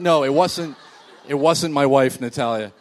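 ordinary delivery

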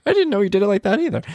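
high, quick amused reply; amused surprise. Retold or imitated. Father answering his daughter, high and amused. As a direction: toss it back, laughing in surprise.

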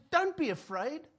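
a touch of lofty podium preaching; mildly lofty, lecturing from a podium; podium lecture. Retold or imitated. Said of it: "standing on a podium saying".

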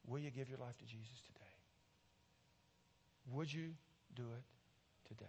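soft, earnest pleading with long pauses; hushed altar appeal. Real, not acted. Preacher inviting listeners to accept Jesus, hushed appeal. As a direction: let each line drop quietly, giving it room to land.

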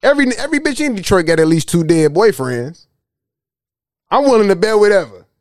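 usual speaking voice

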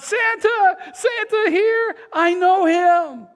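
high, slow mock shout; Buddy shouting to the store crowd, high excited yell. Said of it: "And Buddy yells out".

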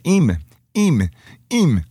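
"im" as a Russian-accented "eem"; coached syllable. Shown as a demonstration. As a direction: drill "im" as a separate, falling "eem". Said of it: "Say this".

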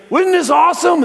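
high, loud showman call; hyping the crowd. Retold or imitated. Walker hyping the crowd, high loud call.

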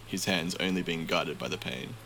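ordinary delivery

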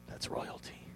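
hushed whisper of realization; awed whisper. Retold or imitated. Preacher recalling his own thought, whispered in awe. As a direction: breathe it out in wonder.

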